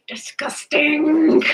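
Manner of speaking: slow, breathy whisper of disgust. Real, not acted. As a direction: breathe the word out, grossed out. Wiping hands after cash.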